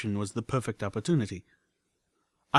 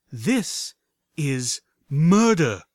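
slow, loud condemnation; drawn-out, high, loud accusation; accusing; 4 semitones higher, 7 dB louder, much slower than usual. Acted. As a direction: lean hard into the word murder. Velvet accusing with petrifying stare.

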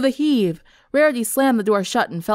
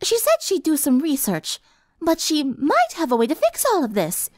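refined lady voice; 8 semitones higher than usual. A narrator's reading. Rarity voiced.

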